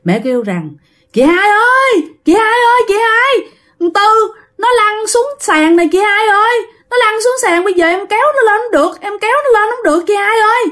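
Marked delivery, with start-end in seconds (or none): 1.1-10.6 high-pitched alarmed cry for help; la làng. Acted. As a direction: call out urgently for big sister, high and insistent. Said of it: "Mẹ của bạn mới la làng lên". (la làng cầu cứu, giọng cao gấp gáp)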